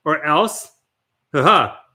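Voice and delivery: ordinary delivery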